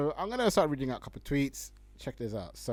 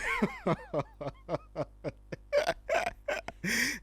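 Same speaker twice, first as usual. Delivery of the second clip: high laugh; 10 semitones higher than usual. Real, not acted. Laughing at own remark.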